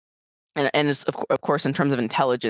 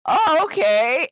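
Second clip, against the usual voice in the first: high, slow, drawn-out agreement; naive agreement; 11 semitones higher, 4 dB louder, much slower than usual. Retold or imitated. American traveler accepting misheard airport directions, naively agreeing. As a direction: cheerfully accept it with exaggerated high pitch.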